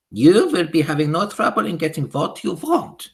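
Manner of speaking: loud, high scornful witch voice with a foreign lilt; Grand High Witch shouting. Acted. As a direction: sneer at the cheap shops. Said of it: "shouted the Grand High Witch".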